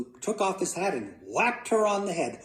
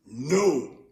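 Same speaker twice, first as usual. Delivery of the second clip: louder, emphatic father's no; firm refusal; 5 dB louder than usual. Acted. Father turns down Luke's second guess, loud firm no. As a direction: put force into the refusal.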